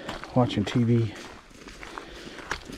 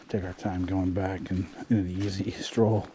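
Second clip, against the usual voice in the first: ordinary delivery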